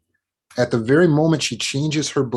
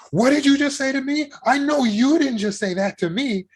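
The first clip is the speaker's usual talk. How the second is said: much higher, indignant disbelief; mock offended outrage. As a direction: play the offended spouse. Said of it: "And you get angry".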